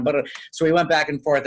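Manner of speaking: ordinary delivery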